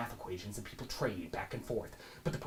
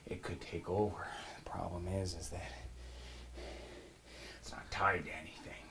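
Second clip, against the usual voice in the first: hushed whisper; 2 semitones lower, 6 dB quieter than usual. Acted. Conspiratorial hushed aside.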